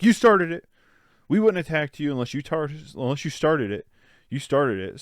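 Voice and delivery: mock angry accusation. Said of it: "And he's like".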